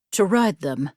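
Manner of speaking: ordinary delivery, nothing marked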